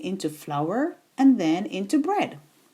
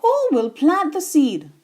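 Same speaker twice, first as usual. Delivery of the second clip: loud, higher call across the yard; calling loudly; 5 semitones higher, 4 dB louder than usual. A narrator's reading. Little red hen calling to the animals, loud call. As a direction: call out to the whole barnyard. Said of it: "And she called loudly".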